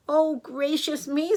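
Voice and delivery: put-on high, drawn-out girlish surprise. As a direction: voice a polite little girl, slightly flustered.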